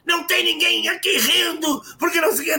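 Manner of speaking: high scolding shout. Retold or imitated. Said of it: "E elas". (as diretoras repreendendo os alunos em tom alto)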